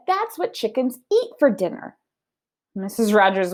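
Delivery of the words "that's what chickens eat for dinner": innocent Amelia Bedelia. Acted. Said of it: "said Amelia Bedelia".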